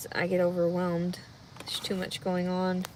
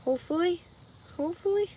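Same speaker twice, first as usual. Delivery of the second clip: anxious; 9 semitones higher, faster than usual. Real, not acted. Anxious hoping.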